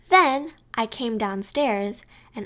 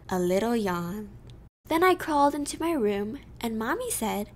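ordinary delivery, nothing marked

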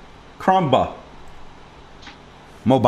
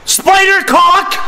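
mock shout; 9 semitones higher, 6 dB louder, slower than usual. Yelling into class call.

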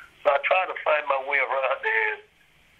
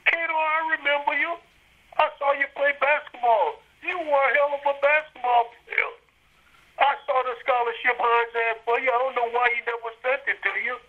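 higher, lightly voiced athletic director, friendly; Dean Jones; 9 semitones higher than usual. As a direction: play the athletic director recalling the player, voice raised. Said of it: "And Dean Jones said".

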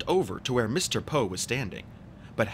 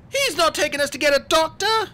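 put-on very high, loud child's shout; high-pitched desperate shout; shouting boy; 8 dB louder than usual. A narrator's reading. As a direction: shout a protest in a boy's voice. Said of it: "Klaus shouted".

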